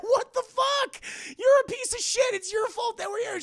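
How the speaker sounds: high, loud screeching accusation; mock furious outburst. Anna blames dad for their exile, screeching fury. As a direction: scream the blame at him.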